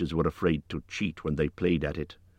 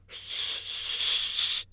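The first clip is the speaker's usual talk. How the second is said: long voiceless hiss; upset creature hiss; 4 dB quieter than usual. A narrator's reading. Gollum upset at guessing wrong, long hiss. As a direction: let out a frustrated hiss. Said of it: "more upset than ever".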